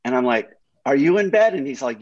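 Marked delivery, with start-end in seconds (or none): 0.9-1.5 higher, louder disbelieving question; startled to be invited over at dawn; mock surprise. Retelling his reply to Hoff's text, mock surprised.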